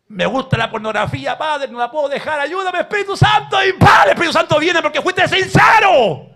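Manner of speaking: climbing from plea to full preacher shout; pleading prayer rising to a shout. Acted. Preacher acting out a sinner begging God, then shouting.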